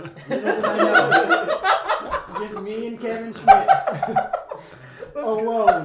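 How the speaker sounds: loud, high laughter through the words; laughing while telling. Real, not acted. Storyteller tells friends it was only the two of them, laughing hard. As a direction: crack up as you say it.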